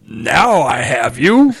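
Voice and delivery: slow, menacing villain line; movie villain voiceover. Retold or imitated. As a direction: draw out the threat like a trailer baddie. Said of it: "in a voiceover booth".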